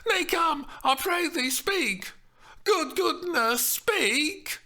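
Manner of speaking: high urgent pleading; begging Juliet. Acted. Juliet begging the nurse to speak, high and urgent.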